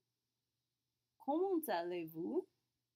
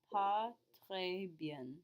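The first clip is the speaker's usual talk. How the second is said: slow model; much slower than usual. Shown as a demonstration. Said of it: "we're gonna say".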